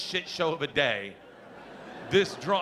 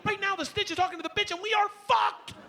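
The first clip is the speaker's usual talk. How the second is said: much higher, fast alarmed rant; panicked rant. Retold or imitated. Dad explaining to daughter they're in trouble, panicked. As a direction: rush it in panic.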